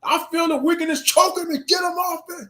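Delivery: high, strained shout as the suffering horse; voicing the horse. Horse begging the crowd to get its rider off, high shout. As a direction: cry out like a horse being crushed. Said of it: "that horse would be like".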